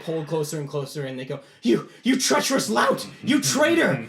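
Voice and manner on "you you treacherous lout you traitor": high, loud cursing; outraged yelling. Acted. Knights pulled closer to the circle, outraged yelling. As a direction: hurl insults at a traitor at full voice. Dragged knights curse the boy.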